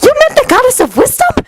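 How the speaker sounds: very high, amazed question; high-pitched thrilled disbelief; excited gasp. A narrator's reading. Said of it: "Hermione gasped excitedly".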